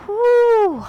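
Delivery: high, loud whoo of relief; triumphant whoop. Real, not acted. Instructor finishing the last curtsy lunge, loud whoop. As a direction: let out a big cheer.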